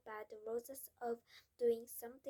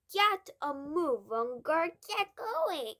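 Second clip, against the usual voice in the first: louder, slow, impatient order; loud impatient father; 5 semitones higher, 14 dB louder, slower than usual. Acted. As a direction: hurry the girl up, loudly. Father hurrying girl.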